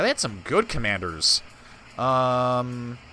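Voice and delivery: ordinary talk, nothing marked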